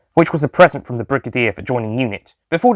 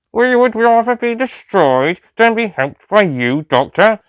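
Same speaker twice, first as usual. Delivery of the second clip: Cyber Lieutenant; 10 semitones higher, 3 dB louder, slower than usual. A narrator's reading. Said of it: "the Cyber Lieutenant said".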